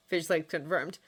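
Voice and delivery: ordinary delivery, nothing marked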